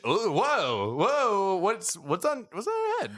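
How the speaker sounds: rising shocked whoa, high pitched; startled by something strange; surprised. Acted. Sees a horn on Skiff's head.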